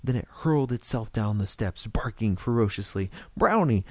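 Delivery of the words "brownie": high, loud call to the dog; shout. A narrator's reading. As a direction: shout the dog's name to stop it. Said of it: "George shouted".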